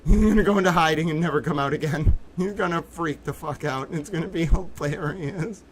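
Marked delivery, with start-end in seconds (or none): none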